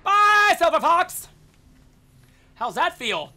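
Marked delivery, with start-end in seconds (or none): 0.0-1.3 loud, high, gloating goodbye; high-pitched called-out send-off; taunting farewell. Real, not acted. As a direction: holler a mock-friendly goodbye.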